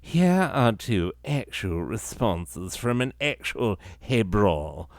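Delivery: mock posh British accent; stuffy British guy. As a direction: read it like a pompous stuffy-nosed Brit. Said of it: "generic sort of stuffy nose British guy".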